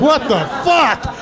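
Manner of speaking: slow disbelief. Retold or imitated. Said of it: "And I was like".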